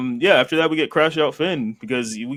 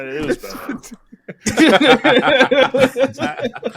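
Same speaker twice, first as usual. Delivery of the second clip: talking through real laughter; words breaking up with laughter; laughing; 11 semitones higher, 4 dB louder, slower than usual. Real, not acted. Co-host cracking up over the haters joke, laughing.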